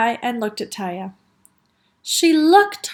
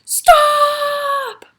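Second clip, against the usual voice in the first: high, held shout; loudest possible yell; 14 semitones higher, 6 dB louder than usual. A narrator's reading. Taya picked up by the ear, yelling. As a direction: holler it as loud as you can. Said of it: "yelled as loudly as she could".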